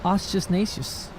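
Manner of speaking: Ostinacious said slowly as a fumbled guess; mispronounced ostentatious. Real, not acted. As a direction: stumble slowly through Ostinacious. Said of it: "worried about the word".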